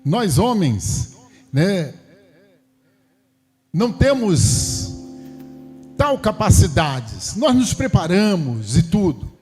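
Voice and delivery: high-pitched, emphatic sermon delivery; impassioned preaching. Real, not acted. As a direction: preach it high, drawing out the key words. Preaches with raised voice.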